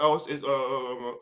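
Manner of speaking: low, soft, halting stammer; stammering visitor. Retold or imitated. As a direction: mumble like a nervous stranger at the door. Imitating hesitant visitor at door.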